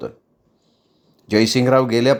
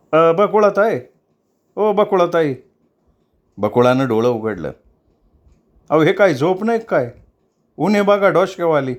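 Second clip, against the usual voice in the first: high raised calling voice of a maid; calling to wake her; 5 semitones higher than usual. Acted. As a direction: call her up from sleep, lively. Sakhya calls Bakula awake.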